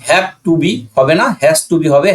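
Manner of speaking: ordinary talk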